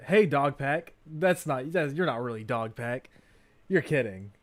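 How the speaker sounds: usual speaking voice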